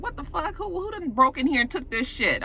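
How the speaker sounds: high-pitched, hushed disbelief; mock shock at a discovery; startled outrage. Retelling his finding the drugs missing, startled outrage.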